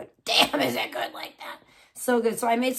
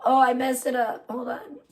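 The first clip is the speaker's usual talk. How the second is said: ordinary talk, nothing marked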